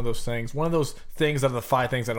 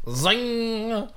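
mocking echo; 8 semitones higher than usual. Mocking co-host's word.

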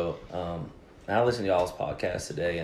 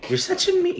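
much higher mock whine of the wife; 3 dB louder than usual. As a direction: squeak it up high, teasing, like the wife. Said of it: "she called me a meanie".

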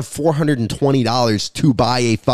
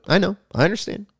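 mock high reassurance; 8 semitones higher, 3 dB quieter, faster than usual.

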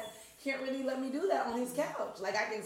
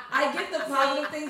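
laughing; 4 semitones higher, 9 dB louder than usual. Real, not acted. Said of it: "I'm joking".

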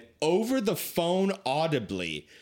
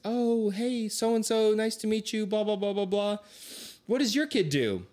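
higher, chirpy small-talk voice of a parent; breezy mingling parent, all pleasantries; chirpy parent chatter; 6 semitones higher than usual. Retold or imitated. Parents meeting at dorm move-in, chirpy higher small talk.

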